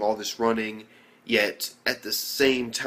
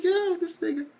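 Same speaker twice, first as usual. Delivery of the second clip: much higher, disbelieving put-on voice; amazed reaction. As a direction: squeak it out in stunned disbelief. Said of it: "I'm just like".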